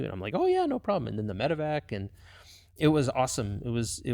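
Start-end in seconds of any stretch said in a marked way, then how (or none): 0.3-1.1 bright, much higher eager agreement; eager yes. Retold or imitated. Pilot happily agreeing to extra passenger flights, eager. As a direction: jump at the chance to help out.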